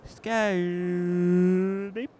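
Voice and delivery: normal talking voice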